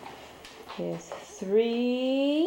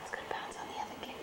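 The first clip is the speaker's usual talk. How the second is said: whisper; 12 dB quieter, much faster than usual. Real, not acted.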